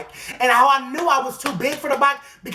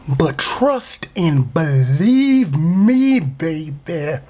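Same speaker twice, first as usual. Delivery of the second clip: slow, drawn-out menace; mock threat; 5 semitones lower, much slower than usual. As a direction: stretch each phrase into a hammy warning.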